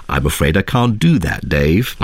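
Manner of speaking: calm computer villain voice, dropping low at the end; HAL impression. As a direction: imitate the movie AI, sinking on the final word. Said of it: "That's my, my best hal voice".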